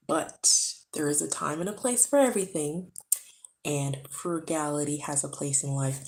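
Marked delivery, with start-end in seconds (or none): none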